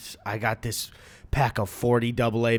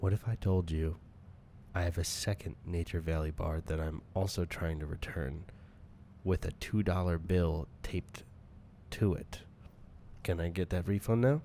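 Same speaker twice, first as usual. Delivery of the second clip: low, much softer secret bribe; conspiratorial hush; 5 semitones lower, 6 dB quieter than usual. Pretend. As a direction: lean in and murmur the offer. Customer leans in, conspiratorial.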